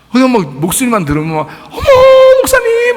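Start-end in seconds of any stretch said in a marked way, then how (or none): 1.7-2.9 high, gushing squeal of delight; gushing pastor's wife. Retold or imitated. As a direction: coo "목사님" like an excited fan. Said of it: "막, 막 이래요". (한껏 들뜬 높은 목소리로 반기는 사모님)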